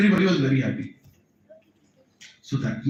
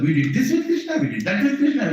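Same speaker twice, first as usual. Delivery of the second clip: high, eager boasting; eager calves; 8 semitones higher than usual. Retold or imitated. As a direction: boast brightly like excited calves. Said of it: "Our children tell us".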